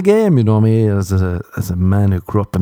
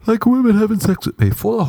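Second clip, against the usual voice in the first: mock high voice; 12 semitones higher than usual.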